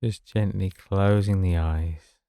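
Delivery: guided meditation. Acted. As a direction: ease them gently into rest, unhurried. Begins leading a sleep meditation.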